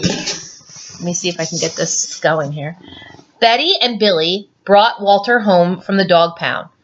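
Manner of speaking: ordinary delivery, nothing marked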